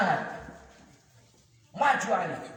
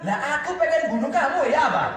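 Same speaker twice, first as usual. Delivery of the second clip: loud, raised, cheeky retort; defiant comeback; 6 semitones higher, 5 dB louder than usual. Acted. Ali defying Amr to his face, loud. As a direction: throw it back boldly.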